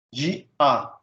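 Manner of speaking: "De A" as two separate syllables; split hiatus. Shown as a demonstration. Teacher showing a hiatus, "De A" in two beats. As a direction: break cleanly between "De" and "A". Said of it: "devem ser separados em duas sílabas".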